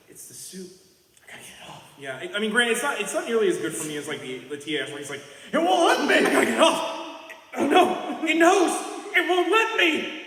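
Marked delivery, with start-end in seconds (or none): 0.1-1.8 unvoiced, desperate hushed whisper; creeping nightmare whisper. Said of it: "I kinda like it where he's like". 5.5-10.2 high, loud frantic yelling; over-the-top panicked shout. Retold or imitated. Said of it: "it's a little over the top".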